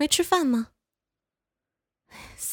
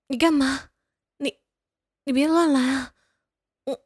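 startled, louder and raised pitch; alarmed protest; 3 semitones higher, 3 dB louder than usual. Acted. Startled caretaker yelling at feverish friend, alarmed and loud. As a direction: push him off in a panic.

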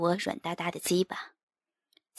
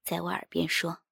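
plain reading voice

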